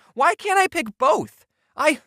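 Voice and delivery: usual speaking voice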